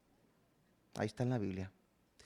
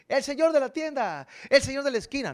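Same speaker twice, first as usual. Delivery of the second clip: mock casual title; 8 semitones higher, 5 dB louder, faster than usual. Mock casual usage of señor.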